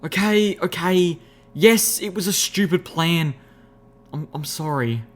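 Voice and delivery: desperate apology. A narrator's reading. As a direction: confess and plead with your friends desperately. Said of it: "I looked at my friends desperately".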